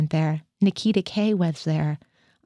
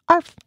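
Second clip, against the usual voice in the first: dog bark; 10 semitones higher, 7 dB louder than usual. Pretend. Dog-man barks at officer.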